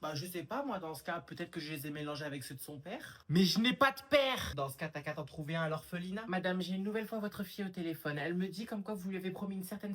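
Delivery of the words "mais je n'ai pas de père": loud, high, drawn-out protest; indignant outburst. Acted. Child hits back at mother over a missing father, indignant outburst. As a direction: protest it at full volume. Indignant retort in family squabble.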